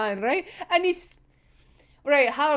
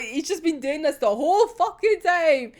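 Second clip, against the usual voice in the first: ordinary delivery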